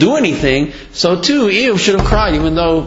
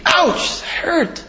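imitation cry of pain, pitched way up; mock pained scream; 3 dB quieter, much slower than usual. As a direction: act out a sudden "ouch" of pain. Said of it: "You scream. You go".

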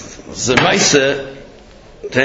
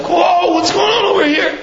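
high, alarmed outcry; startled queen. Acted. Queen to the screaming envoy, startled high outcry. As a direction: yelp it in shock, pitched way up.

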